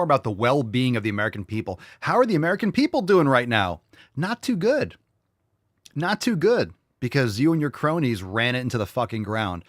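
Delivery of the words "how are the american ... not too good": sarcastic. Acted. Sarcastic question and answer.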